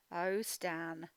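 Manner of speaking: normal talking voice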